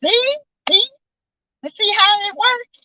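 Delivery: high, delighted see how it works; excited see. Real, not acted. Celebrating her cure to the listeners, high and delighted. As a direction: pitch up, show off the proof.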